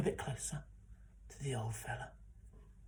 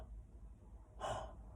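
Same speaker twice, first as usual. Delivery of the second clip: gasp; 9 dB quieter than usual. Acted. Finding the flower.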